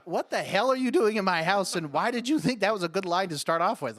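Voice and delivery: hammed-up incredulous protest; indignant reaction. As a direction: demand an explanation from an absurd intruder. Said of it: "I would be like".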